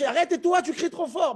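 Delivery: high-pitched, lightly acted scolding; God's rebuke. God telling Moshe off for crying out, mock scolding. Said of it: "Dieu dit à Moshe".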